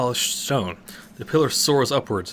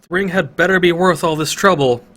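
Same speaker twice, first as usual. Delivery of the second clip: loud, higher grumbling shout; yelling down; 7 semitones higher, 8 dB louder than usual. Acted. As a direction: call down the pillar. Lydia yelling down.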